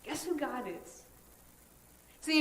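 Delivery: ordinary delivery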